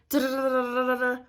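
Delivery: rapid stuttering motor noise; rattling, rolled engine sound; engine cranking imitation. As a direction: imitate a starter that won't catch. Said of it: "And then it goes".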